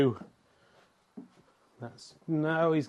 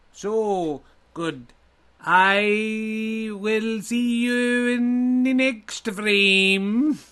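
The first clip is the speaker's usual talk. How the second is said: higher, very slow, drawn-out proclamation; grand Viking farewell; 6 semitones higher, 4 dB louder, much slower than usual. Acted. Viking me signs off to Richard, slow and grand. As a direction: stretch every word like a grand hero. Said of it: "You sound like the guys from Galaxy Quest".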